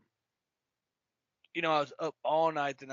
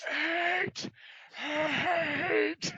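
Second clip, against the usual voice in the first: much higher, strained laughing "eight"; strained throat; 12 semitones higher than usual. Retold or imitated. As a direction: croak it out, voice worn from yelling. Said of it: "my throat's like".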